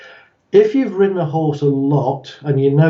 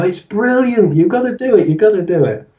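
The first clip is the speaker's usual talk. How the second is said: higher, gushing mock enthusiasm; acted excited peer pressure; enthusiastic urging; 5 semitones higher than usual. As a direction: egg a friend on. Returning jumpers urging the friend.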